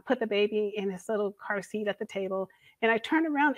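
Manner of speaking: usual speaking voice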